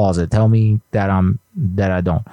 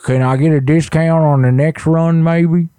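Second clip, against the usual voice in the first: prank caller voice; 9 semitones higher, 3 dB louder than usual. Pretend. Prank caller asking for discount.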